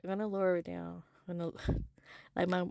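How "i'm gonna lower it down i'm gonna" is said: softer, quicker, bringing it down; lowering it down. Real, not acted. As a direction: pull the volume back on purpose.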